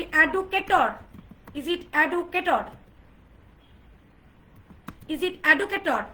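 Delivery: এডুকেটর, educator as e-du-ke-TOR, hard d and full TOR; common mispronunciation. Shown as a demonstration. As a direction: slowly question educator said with plain du. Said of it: "আমরা বলি". (এডুকেটর ভুল উচ্চারণে, ড দিয়ে)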